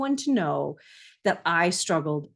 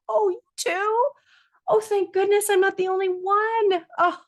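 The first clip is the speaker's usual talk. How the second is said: much higher and louder, gushing with relief; relieved delight; 13 semitones higher, 5 dB louder than usual. Retold or imitated. A mom hearing another mom struggles too, relieved squeal. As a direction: squeal it like a weight just lifted. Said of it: "And I'm like".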